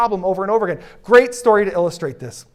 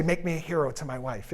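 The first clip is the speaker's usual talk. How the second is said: softly asking; 2 semitones lower, 9 dB quieter than usual. Shown as a demonstration.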